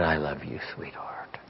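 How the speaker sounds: soft, low, fading to a whisper; tender murmur. Retold or imitated. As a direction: answer a child lovingly, very softly. Father answers daughter in hug.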